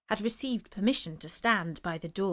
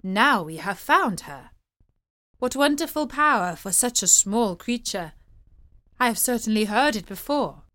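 excited courtier; 5 dB louder than usual. Acted. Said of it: "said a young courtier".

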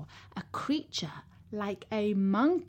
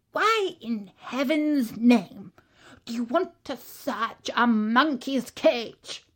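zoo keeper; 3 semitones higher, 5 dB louder than usual. A narrator's reading. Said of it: "he asked".

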